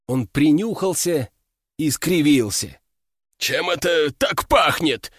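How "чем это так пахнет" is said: puzzled and disgusted, rising high; sniff, then ask in disbelief; confused demon general. A narrator's reading.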